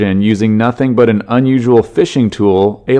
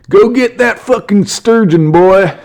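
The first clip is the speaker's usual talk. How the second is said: much higher, slow hammed-up yokel dad; 10 semitones higher, slower than usual. Dad cheering his boys from the bank, hammed-up yokel voice. Dad filming sons catch fish.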